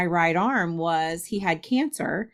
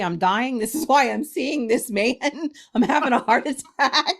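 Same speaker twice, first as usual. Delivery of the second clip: mock panic; 5 semitones higher than usual. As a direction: pitch climbing into comic alarm. Retelling her panic at chest pain.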